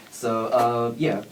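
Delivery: usual speaking voice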